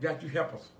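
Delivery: usual speaking voice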